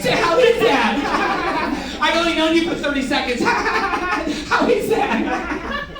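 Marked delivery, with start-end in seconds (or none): 0.1-2.0 high, drawn-out question through laughter; smiling, laughing. Shown as a demonstration. Trainer leading the room in the line, laughing. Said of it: "say it with me and smile". 4.5-5.9 high, drawn-out question through laughter; smiling, laughing. Shown as a demonstration. Trainer leading the room in the line, laughing. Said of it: "say it with me and smile".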